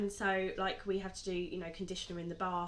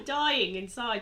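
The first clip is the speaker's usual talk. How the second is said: ordinary talk, nothing marked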